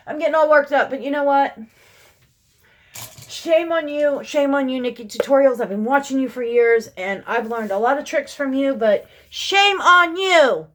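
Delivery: loud, high scolding rant; all worked up. Real, not acted.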